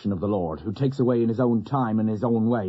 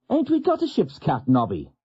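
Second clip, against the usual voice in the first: much higher cheeky stoker; sailor's voice; 6 semitones higher than usual. Retold or imitated. Stoker asking his mate Nobby, high innocent voice. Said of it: "he turns to his oppo and says".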